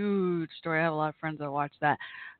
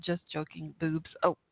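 ordinary delivery

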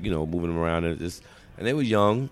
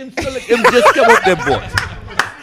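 exaggerated African accent, slower, much higher and louder; mock African accent; 13 semitones higher, 7 dB louder, slower than usual. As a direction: tease his accent by overdoing it. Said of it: "you still roll your R's".

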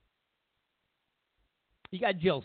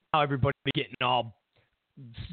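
usual speaking voice

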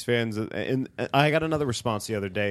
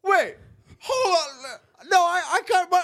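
mock outraged fan; 5 dB louder, slower than usual. Mocking outraged opposing fan.

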